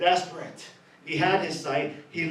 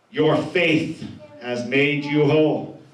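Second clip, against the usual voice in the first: slow emphatic quote; 5 semitones lower, slower than usual. Acted. Quoting Jesus, drawn out.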